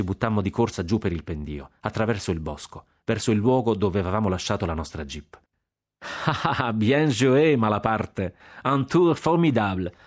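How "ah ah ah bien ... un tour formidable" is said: high, gleeful cry breaking out of laughter; laughing shout. A narrator's reading. Jack running off after the prank, shouting gleefully. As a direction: crow with delight, laughing as you call. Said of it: "gridava Jack correndo".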